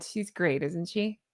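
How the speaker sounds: soft, low and understated; calm, understated. Retold or imitated. Batman answering a compliment on Vicky Vale, calmly understated. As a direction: say it quietly, no fuss at all. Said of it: "had a very calm, understated response".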